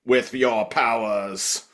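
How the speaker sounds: slow, drawn-out evil chant; slow hammed-up villain summoning; mock villain incantation. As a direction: intone it like a cartoon villain.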